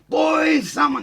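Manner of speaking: high, loud shout for help; calling out for help. Retold or imitated. Chased by a gang, he yells to his mates for help. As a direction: yell for your mates, desperate. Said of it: "I'm calling out".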